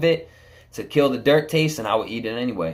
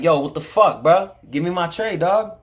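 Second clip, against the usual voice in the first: retold snapping; 6 semitones higher than usual. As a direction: snap at the guard for the tray. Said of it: "I start snapping like".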